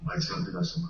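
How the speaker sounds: bad phone line cutting out; radio breaking up. Real, not acted. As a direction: voice dropping in and out on a weak signal. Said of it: "it sounds like you're breaking up a bit".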